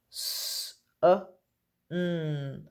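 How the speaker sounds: each letter sound said separately, with pauses between. Shown as a demonstration. Teacher to pupils, sounding out a word letter by letter. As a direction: split the word into its single sounds for the class. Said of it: "Sound out sun for me".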